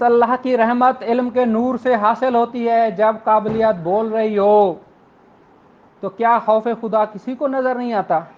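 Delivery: normal talking voice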